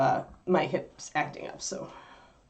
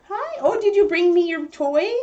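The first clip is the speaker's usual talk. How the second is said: high sing-song puppy voice; 11 semitones higher, 4 dB louder than usual. Real, not acted. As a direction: gush at the puppy, much higher. Dog brings her a toy.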